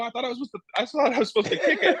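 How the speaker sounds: high, fast bewildered excuse; pleading confused keeper; whiny protest. Retold or imitated. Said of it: "And I go".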